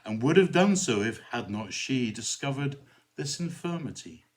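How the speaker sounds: lively, dramatic recitation of a cautionary verse; poem recitation. Acted. Vicar reciting a Belloc poem to viewers, dramatic recitation. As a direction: perform the poem for the audience.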